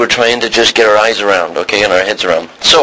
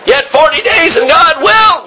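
proclaiming prophet; 14 semitones higher, 4 dB louder than usual. Retold or imitated. Preacher proclaims Jonah's warning.

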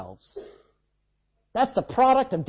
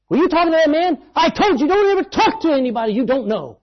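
loud, high telling-off; an angry dad laying down the rule; scolding father; 9 semitones higher, 6 dB louder than usual. Retold or imitated. Father scolding his son for talking to a stranger, loud. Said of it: "that dad gave out and says".

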